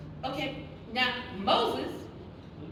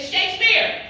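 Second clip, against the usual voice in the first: higher, louder announcement; proud naming; 9 semitones higher, 11 dB louder than usual. Retold or imitated. Naming the new kitten, proud loud announcement. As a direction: proclaim the name proudly. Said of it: "I said".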